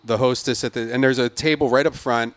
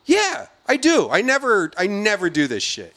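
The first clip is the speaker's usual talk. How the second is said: indignant demand; 8 semitones higher than usual. Retold or imitated. Demanding the manager.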